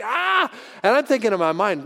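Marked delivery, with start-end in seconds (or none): 0.0-0.5 high-pitched wordless "aah" of rage; mock enraged yell. Irate owner rants at the visitor, mock raging yell. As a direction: imitate a furious man's wordless outburst. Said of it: "This guy is just".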